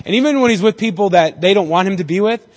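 ordinary talk, nothing marked

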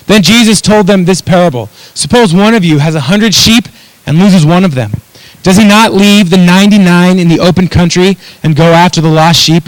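loud, emphatic preacher reading aloud; Scripture reading. A narrator's reading. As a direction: project the Bible passage to the room, punching key words. Reads the parable aloud from Luke.

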